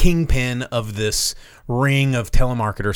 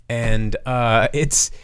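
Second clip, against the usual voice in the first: ordinary delivery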